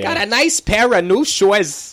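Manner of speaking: hammed-up cartoon mother, stretched out; Bobby's mom. Imitating a cartoon mom to show the Minnesota accent, high and slow. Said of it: "Bobby's mom from Bobby's World".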